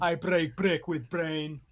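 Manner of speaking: slow, heavy, lightly put-on Austrian accent as Arnold; slow mock Arnold Austrian accent. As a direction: drag out each word in a hammed-up Austrian accent as Arnold. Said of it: "he's just like".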